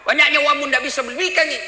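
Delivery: angry wife. Retold or imitated. Wife scolding the camat husband over a phone, angry yell. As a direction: scold him hard for being stingy. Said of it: "Marah mamanya".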